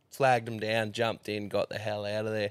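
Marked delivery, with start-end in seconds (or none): none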